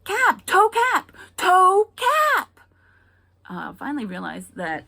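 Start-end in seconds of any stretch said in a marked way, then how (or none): 0.0-2.6 put-on high, loud insistent toddler yell; toddler shout repeated. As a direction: kid insisting again. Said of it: "what she was saying".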